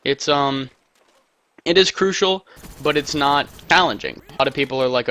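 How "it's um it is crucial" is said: normal talking voice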